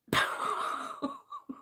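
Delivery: high, wheezy laugh; real breathless giggling fit; helpless laugh. Real, not acted. Cracks up while reading aloud.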